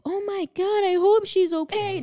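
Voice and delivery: high, loud gasping worry; panicked tourists. Retold or imitated. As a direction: play the alarmed onlookers. Other group reacting in panic.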